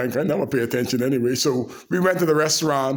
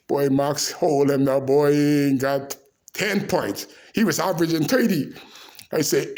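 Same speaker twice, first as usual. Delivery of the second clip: normal talking voice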